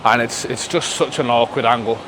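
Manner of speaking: ordinary talk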